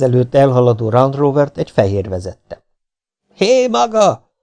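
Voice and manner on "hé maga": high, carrying call to a passing driver; shouting out. A narrator's reading. Custon hailing the Land Rover driver, high shout. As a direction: hail the car from afar. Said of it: "kiáltott Custon".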